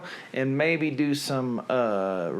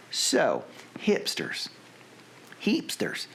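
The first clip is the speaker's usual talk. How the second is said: put-on Southern reading; Southern take on "hipsters"; 4 semitones higher, slower than usual. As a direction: feel out the word in a Southern accent. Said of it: "to do in a Southern accent".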